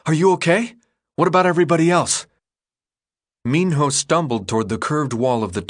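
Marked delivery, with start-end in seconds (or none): 0.1-2.2 anxious. A narrator's reading. Thomas calling anxiously to Minho.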